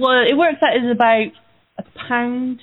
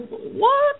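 high, sharp disbelieving "What?"; mock shock. Host retelling surprise at the caller, high shock. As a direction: jump way up in pitch, startled. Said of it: "I was like".

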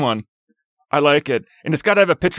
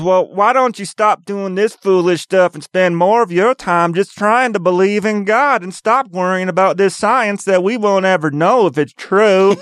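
higher-pitched mock bumpkin preaching; 4 semitones higher than usual. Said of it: "the one dumb hick that comes up".

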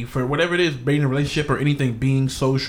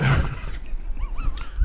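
high laugh. Real, not acted. Laughs after ice remark.

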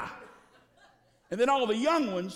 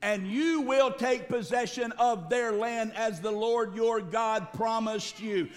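normal talking voice

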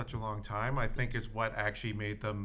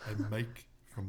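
Mike from Canmore voice; 4 semitones lower, 6 dB quieter than usual. Retold or imitated. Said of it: "a clip of him saying it".

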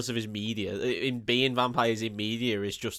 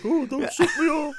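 high-pitched silly protest; mock plea; 13 semitones higher than usual. Impersonating Peter out of the plane, high mock plea.

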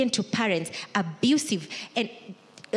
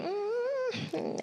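long, high-pitched, softer "mm"; musing hum; 7 dB quieter than usual. Acted. Conceited churchgoer sizing up the room, high musing hum. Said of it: "looking around at the people and thinking".

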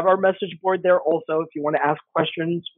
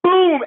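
gunshot boom; 13 semitones higher, 5 dB louder than usual. Retold or imitated. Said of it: "She turns around and she goes".

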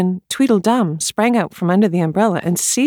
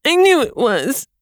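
high, slow cry of dismay; 6 semitones higher, slower than usual. A narrator's reading. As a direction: wail it out as if the worst came true. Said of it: "cried Tweedledum".

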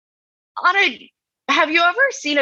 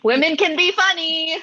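higher-pitched mock-earnest claim; mock insistence; 7 semitones higher than usual. Co-host defending a woman's joke, mock insistence. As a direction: say it high, as if it were news. Mock protest that women are funny.

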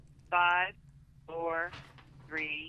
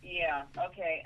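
on speakerphone. Real, not acted. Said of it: "Can totally tell".

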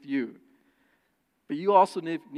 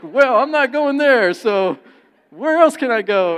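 high, loud, slower exasperated protest; loud mock complaint; frustrated outburst; 8 semitones higher, 14 dB louder than usual. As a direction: vent like you've got nowhere to eat. Frustrated retelling about finding food.

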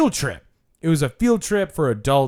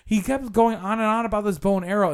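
ordinary delivery

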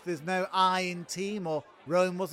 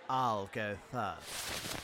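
low sly aside; 11 semitones lower, 12 dB quieter, slower than usual. Acted. Eager start of the mime.

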